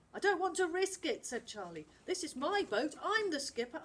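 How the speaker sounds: put-on high, bossy young skipper; stubborn boy voice. Charlie to his crew, bossy and high. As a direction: dig in, it's your boat.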